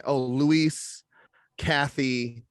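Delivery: ordinary talk, nothing marked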